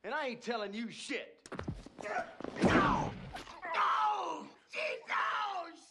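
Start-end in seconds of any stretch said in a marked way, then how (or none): 2.0-5.8 screaming out in sharp pain. Acted. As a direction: howl as if the hand is crushed. Suspect's hand slammed in door.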